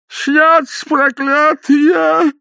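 high, slow drawn-out wail; old man crying out behind the house; old man's cry. A narrator's reading. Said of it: "голос старика".

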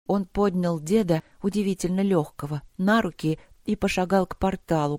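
ordinary delivery

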